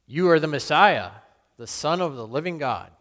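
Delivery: loud, eager proclamation; bold declaration. Retold or imitated. Peter answering Jesus first, loud and eager. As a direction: blurt it out before anyone else.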